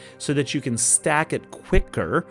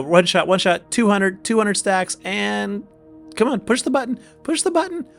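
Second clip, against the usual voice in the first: excited; 7 semitones higher than usual. Real, not acted. Cheering on the big hit.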